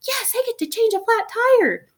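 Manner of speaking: hammed-up gleeful daughter imitation; thrilled daughter. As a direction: mimic a kid thrilled by a chore. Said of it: "But she was excited".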